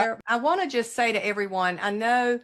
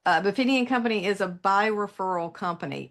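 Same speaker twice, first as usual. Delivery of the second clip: ordinary delivery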